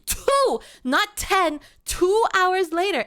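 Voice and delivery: loud, high-pitched mock outrage; exasperated, shouted complaint; indignant rant. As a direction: let them have it, piling up grievances at full volume. Mock quarrel with chat, bone to pick.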